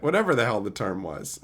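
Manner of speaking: ordinary delivery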